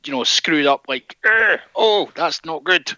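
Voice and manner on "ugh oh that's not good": high pinched disgust; lightly voiced wince of disgust; mock pained grimace. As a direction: pull a screwed-up face as you say it. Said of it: "sort of face".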